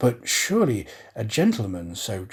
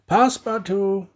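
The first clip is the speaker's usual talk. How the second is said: loud call, pitched up; 8 semitones higher, 5 dB louder than usual. A narrator's reading. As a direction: call his name across the house. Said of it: "and called out".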